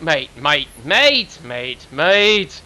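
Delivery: a self-mocking bad Australian accent, repeating mate. Caster mocking Aussie slang to his co-caster, bad Australian accent. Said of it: "I'm completely not Australian".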